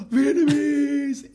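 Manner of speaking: high, loud, drawn-out exclamation; surprised. Real, not acted. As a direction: stretch it out in delighted surprise. Surprised at the name's origin.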